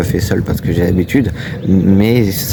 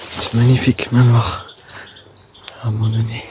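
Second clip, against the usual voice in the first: hushed whispering; 2 semitones higher, 6 dB quieter than usual. Real, not acted. Urban explorer whispering on site so neighbours won't hear, hushed. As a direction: keep your voice down, near-whisper with long pauses. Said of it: "je vais chuchoter".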